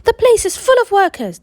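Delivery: high, loud outraged shout; shouting crowd. Acted. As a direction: yell it at the locked gates. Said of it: "the people shouted".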